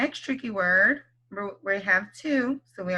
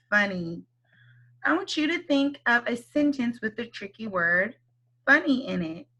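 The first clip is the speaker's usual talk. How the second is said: normal talking voice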